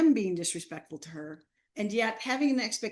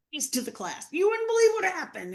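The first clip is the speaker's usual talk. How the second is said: breathless excuse; 11 semitones higher, 5 dB louder than usual. Retold or imitated. Late student bursting in with excuses.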